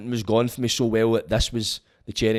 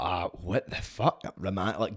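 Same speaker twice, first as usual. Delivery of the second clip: stunned quiet; 4 semitones lower, 9 dB quieter, slower than usual. Retold or imitated. Stunned at being remanded.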